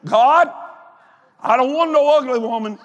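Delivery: comic retold prayer, pitched high; blunt mock prayer. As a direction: pray it out loud and bluntly. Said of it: "So I started praying like this".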